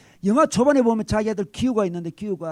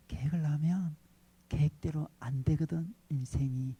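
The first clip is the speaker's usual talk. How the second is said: whispered demo line, softer; softly; 4 semitones lower, 10 dB quieter than usual. Shown as a demonstration. Pastor demonstrates the line hushed, almost whispered. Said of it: "이렇게 이야기하면 돼".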